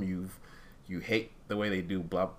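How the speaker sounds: ordinary talk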